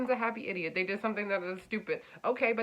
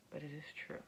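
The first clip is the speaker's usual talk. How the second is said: hushed channelling; 3 semitones lower, 13 dB quieter than usual. Acted. Channelled astrology message, softly delivered.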